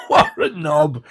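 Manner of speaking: laughing insult. Retold or imitated. Quoting the film's joke to viewers, laughing. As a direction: blurt it out while still laughing.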